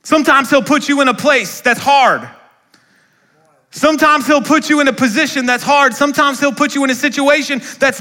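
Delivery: loud, high preaching build; impassioned pulpit refrain; preaching at full force. Real, not acted. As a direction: lift each line like a rising refrain.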